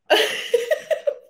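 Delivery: very high, wheezy giggle; real squeaky laughter breaking the sentence; helpless high-pitched giggle. Real, not acted. Laughs at herself mid-sentence.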